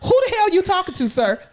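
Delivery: mocking patient. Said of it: "He was".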